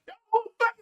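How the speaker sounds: very high, loud startled yell; demonstrated yell. Shown as a demonstration. Showing viewers how he reacted at the door, demonstrated yell. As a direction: act out a full-blast challenge up high. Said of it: "I'm gonna show you a visual interpretation".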